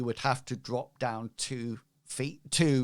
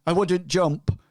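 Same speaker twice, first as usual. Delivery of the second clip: loud, higher warning in character; cautioning voice, pitched up and pushed; non-player character; 6 semitones higher, 8 dB louder than usual. Acted. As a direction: warn in a raised character voice. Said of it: "looks at you and says".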